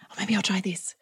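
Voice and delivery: whispered timid mocking; mock pandering husband. Wife mocking her husband's pandering in a whisper. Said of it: "you pander around going".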